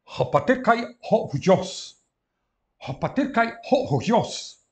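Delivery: grego declamado, alto e agudo; leitura em voz alta. Shown as a demonstration. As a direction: recite o grego para a turma em voz alta. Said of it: "reads Greek aloud for class". (o professor lê a frase grega à turma, declamando alto)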